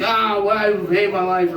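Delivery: a mild mock yell of self-pity; mock drunk scream. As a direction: yell it like a miserable drunk, but only half-hearted. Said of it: "just screaming at the top of my lungs".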